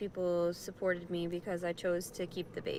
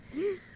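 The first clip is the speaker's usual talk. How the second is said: pained gasp; 10 semitones higher than usual. Real, not acted. Sudden pain.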